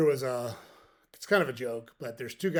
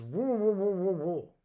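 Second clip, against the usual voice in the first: mumbled through worms, higher and louder, pushing it harder; insistent mouthful mumble; 6 semitones higher, 5 dB louder than usual. Retold or imitated. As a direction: repeat it through a full mouth, more insistent. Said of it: "spits a mouthful of worms out".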